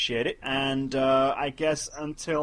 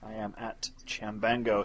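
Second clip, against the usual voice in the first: normal talking voice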